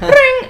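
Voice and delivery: very high, loud ringing noise; imitated phone ring. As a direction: mimic a phone going off. Imitates phone notification going off.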